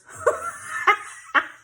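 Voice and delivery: high, loud burst of laughter; a real laugh breaking out. Real, not acted. Laughing at own accent.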